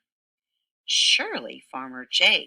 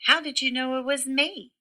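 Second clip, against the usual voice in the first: high, fast put-upon groan; groaning turkey; 8 semitones higher, 3 dB louder, faster than usual. Acted. Turkey found out again by Pig, high fast groan. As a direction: groan it like a caught-out bird. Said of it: "groaned Turkey".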